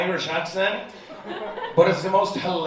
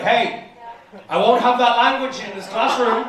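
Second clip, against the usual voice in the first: raised, scolding teacher's voice; mock stern teacher; 5 semitones higher, 4 dB louder than usual. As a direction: tell the kid off, half-joking. Scolding a kid in class.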